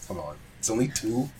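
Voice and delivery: usual speaking voice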